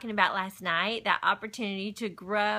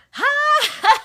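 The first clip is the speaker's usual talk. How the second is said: loud, high-pitched "Ha!"; mock shocked gasp; 11 dB louder than usual. Reacting to being offered a chance to grow, mock shocked. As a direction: blurt a big exaggerated gasp of shock. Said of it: "at first, you're like".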